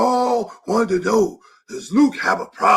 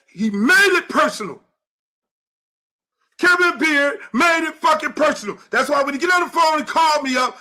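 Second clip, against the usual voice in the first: furious shout; 7 semitones higher than usual. Real, not acted. Coach yelling about Kevin Beard making it personal, furious. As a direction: let the anger boil over, yelling.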